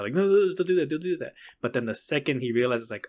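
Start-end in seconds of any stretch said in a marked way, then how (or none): 0.1-1.3 higher-pitched imitation of panic; mock freaking out. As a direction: plead fast as if freaking out, lightly acted. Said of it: "he's, like, freaking out".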